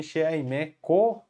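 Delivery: "She aime ko" drawn out slowly, each word separate; slow model answer. Shown as a demonstration. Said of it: "ou pode falar também". (resposta alternativa dita bem devagar)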